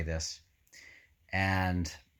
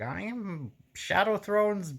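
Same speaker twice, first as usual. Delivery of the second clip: Pust impression; 12 semitones higher than usual. Reader imitating Pust announcing Shadowthrone's message, hammed-up. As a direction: ham up the pompous priest proclaiming his god. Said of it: "Pust is like".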